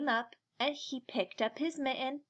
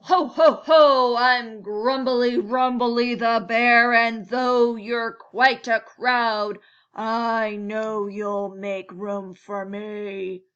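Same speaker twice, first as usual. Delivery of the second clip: booming, slow, much louder bear; big bear voice; 11 dB louder, slower than usual. Acted. Bear booming his name at the crowd inside. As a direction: roar it out like a huge jolly bear.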